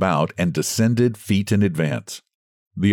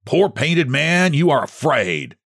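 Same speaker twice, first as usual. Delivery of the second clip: taunting shout; 7 semitones higher than usual. Acted. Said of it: "he shouted".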